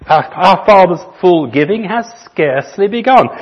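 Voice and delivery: slow, measured recitation of a hymn; hymn verse reading. Acted. Pastor reading the hymn to his church, measured recitation. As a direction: read the verse like a poem to the congregation.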